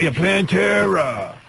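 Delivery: slow drawl. Acted. Drawn-out slow [name].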